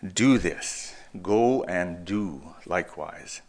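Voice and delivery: slow, weighty "Do" and "do"; stress on DO. Shown as a demonstration. Preacher quoting Jesus again, deliberate stress on "do". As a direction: draw out each "do" as the key word. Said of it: "all the emphasis on the word do".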